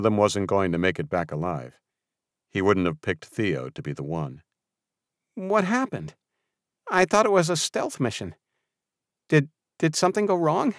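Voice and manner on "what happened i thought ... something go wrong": put-on much higher young kid's voice; a younger boy pipes up anxiously; piping up. A narrator's reading. Bradley, one of the young kids, asking what happened. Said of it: "piped up".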